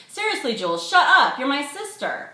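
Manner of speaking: high, loud protest at his sister; exasperated. A narrator's reading. Brother tells his sister to shut up, exasperated. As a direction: cut her off, mortified.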